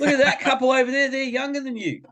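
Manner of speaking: high, fast, laughing retort; laughing argument. Retold or imitated. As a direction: tease the boy through laughter. Dad arguing, laughing.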